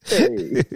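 airy wheezing laugh; breathy high laugh. Real, not acted.

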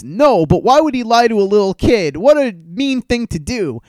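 high, pushed imitation of a hurt friend; a hammed-up wounded protest; mock outraged friend. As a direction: whine it like he's scandalized for the kid. Friend outraged on kid's behalf.

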